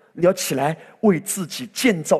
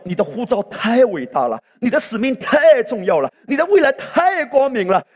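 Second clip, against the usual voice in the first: climbing higher and louder each line; building preaching refrain; 8 semitones higher, 5 dB louder than usual. Real, not acted. (逐句升高的讲道排比)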